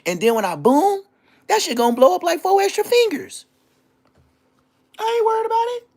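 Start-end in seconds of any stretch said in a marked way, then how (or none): 1.5-5.9 mock voice. Mocking high put-on voice.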